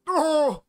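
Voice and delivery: loud, high NPC pain cry; mock yelp of a hurt game character; video game pain noise. Imitating a video game NPC in pain, mock cry. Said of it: "Just making video game N P C pain noises".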